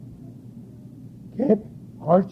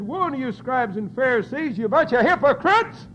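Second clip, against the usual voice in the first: rising, pitched-up preaching cry; preaching woe; 6 semitones higher, 4 dB louder than usual. Acted. Preacher denouncing hypocrites to the congregation, ringing preaching. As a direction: call out the woes from the pulpit. Preacher quoting Jesus' woes.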